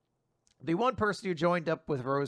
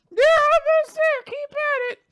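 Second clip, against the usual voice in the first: very high squeaky little sister; 7 dB louder than usual. Acted. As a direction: squeak it out cheerfully. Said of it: "squeaky voice".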